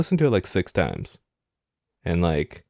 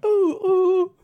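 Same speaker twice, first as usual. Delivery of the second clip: very high [name]-style yelp; falsetto squeal. Retold or imitated. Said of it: "the song goes".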